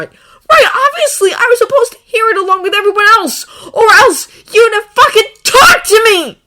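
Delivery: high, very loud, tearful sarcasm; bitter outburst. A narrator's reading. Draco cutting off Harry's excuse, bitter tearful shouting. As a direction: spit out bitter sarcasm at full voice. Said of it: "Draco hissed".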